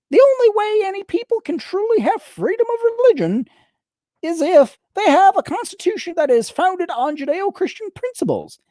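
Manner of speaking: high whiny nasal politician voice; Louis Gohmert impression. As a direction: whine it high through the nose. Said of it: "that is the accent I'm going to use".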